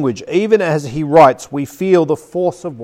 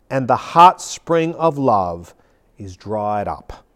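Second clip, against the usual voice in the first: low, solemn sermon close; slow down and let it sink to a hush; grave, fading delivery with long pauses; preaching; 5 semitones lower than usual. Shown as a demonstration. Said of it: "preach it to you, really, as I read".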